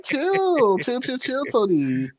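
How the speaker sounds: high, strained cry of "chill"; high-pitched mock panic at Tony; mock alarmed plea. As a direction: beg him to calm down in a mock panic. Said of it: "I'm like".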